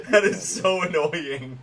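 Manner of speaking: real laughter breaking into the lines; laughing. Real, not acted. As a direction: crack up while saying it. Laughing sympathy at friend's story.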